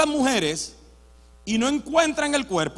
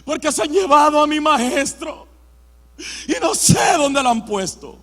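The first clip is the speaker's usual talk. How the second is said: distraught woman; 4 semitones higher, 6 dB louder than usual. Acted. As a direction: wail it as a grieving woman in a drama. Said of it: "haciendo papel de mujer". (lamento fuerte de mujer desconsolada)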